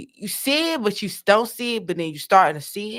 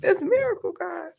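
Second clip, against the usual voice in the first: mock confused prayer; 5 semitones higher than usual. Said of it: "I'm like".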